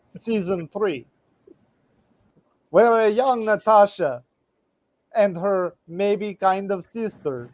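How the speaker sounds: put-on a hammy, lightly done Russian accent telling the plot; a mock Russian narrator who is openly bad at it. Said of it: "my bad Russian accent".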